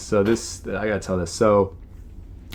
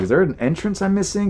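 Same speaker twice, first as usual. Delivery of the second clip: confused; 10 semitones higher than usual. Retold or imitated. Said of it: "I'm like".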